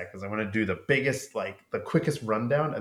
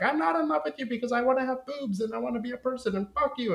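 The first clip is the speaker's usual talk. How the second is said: high fast mock brat; hammed-up high bratty tantrum; bratty Claudia; faster than usual. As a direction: rattle it off like a spoiled child. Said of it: "she's like".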